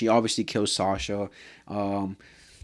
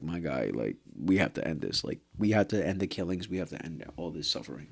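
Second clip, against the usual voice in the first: lower, softer, earnest voice for Jean; solemn plea; 6 semitones lower, 7 dB quieter than usual. Retold or imitated. Vlogger voicing Jean urging Connie to stop, low earnest plea. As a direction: plead it low and serious. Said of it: "John made him realize, like".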